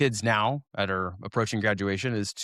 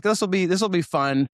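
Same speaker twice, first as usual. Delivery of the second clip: bright, hopeful self-talk, pitched up; upbeat inner voice, a bit louder; eager; 7 semitones higher, 3 dB louder than usual. Retold or imitated. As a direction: sound keen about the new class. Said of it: "So, like".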